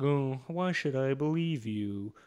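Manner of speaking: slow, drawn-out mock doubt; lightly voiced sceptical cop. Cop doubting the man with no teeth, lightly mocked scepticism. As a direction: drag it out like an unconvinced cop.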